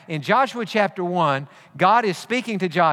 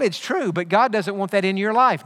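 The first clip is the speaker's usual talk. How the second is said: ordinary talk, nothing marked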